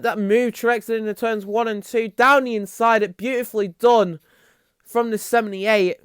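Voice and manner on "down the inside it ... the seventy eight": ordinary delivery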